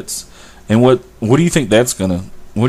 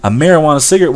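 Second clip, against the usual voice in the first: cigarette hit louder and higher; stress on cigarette; 5 semitones higher, 3 dB louder, faster than usual. Shown as a demonstration. Stressing that weed is smoked in a cigarette, with a hard stress on cigarette. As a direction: lean hard on the word cigarette. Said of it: "I stress the word cigarette".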